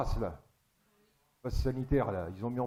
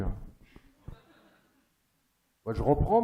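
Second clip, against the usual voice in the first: usual speaking voice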